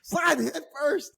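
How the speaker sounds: high, slow, laughing disbelief; half-laughing, half-crying at the idea; stunned disbelief. Retold or imitated. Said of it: "I was like".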